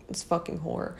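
whispered under the breath; breathy whispered aside; quiet insult. Retold or imitated. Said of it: "me quietly saying that".

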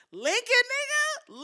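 loud rising disbelief on Lincoln; Lincoln, said like you can't believe it; outraged rising Lincoln; incredulous. Real, not acted. Incredulous at Trump's Lincoln claim.

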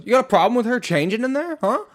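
high, loud, taunting challenge; hostile confrontation. Retold or imitated. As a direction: imitate a belligerent stranger squaring up. Aggressive stranger quoted.

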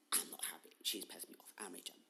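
low, quiet, petulant grumble; sulky brat. Retold or imitated. Retelling the complaint about no goodbye, low bratty sulk. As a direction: imitate a sulking brat under your breath. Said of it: "I was being a brat about it".

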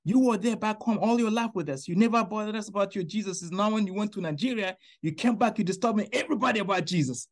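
a hint of friends scolding him; angry friends. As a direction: friends complaining he keeps preaching, mildly mock angry. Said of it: "they get so mad".

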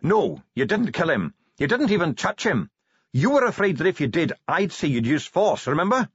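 calm a panicking man quickly; dwarf gripping his hands, urgent; fast and urgent. A narrator's reading. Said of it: "spoke, fast and urgently".

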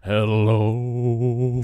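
long, drawn-out low hello; strained attempt at singing low; as low as possible. Shown as a demonstration. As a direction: sink to the bottom of your range and hold it. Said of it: "Go as low as you can hit".

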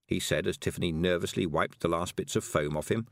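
usual speaking voice

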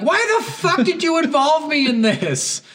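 high, drawn-out outraged complaint; mock exasperation. Player to troublesome gang member, hammed-up exasperation. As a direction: protest like a dragged-in accomplice.

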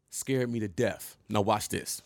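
usual speaking voice

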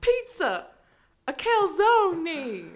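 loud, high New York attempt gone country western; badly faked New York accent, stretched out. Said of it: "New York words in a country western accent".

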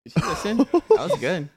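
high, loud excited cheer. Real, not acted. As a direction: cheer her stunt. Reacting to Millie's climb.

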